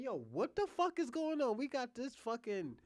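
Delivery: high-pitched mock-indignant outburst; mock outrage. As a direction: imitate an outraged viewer, pitch jumping up. Acts out viewer's outraged reaction.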